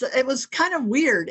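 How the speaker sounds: ordinary talk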